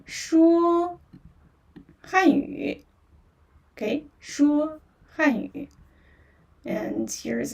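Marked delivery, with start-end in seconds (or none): 0.1-2.8 说 then 汉语, pitched high and said slowly and clearly; Mandarin demo. Shown as a demonstration. Teacher models 说汉语 for English-speaking learners, raised and clear. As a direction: model 说 汉语 carefully for the class. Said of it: "So you just say".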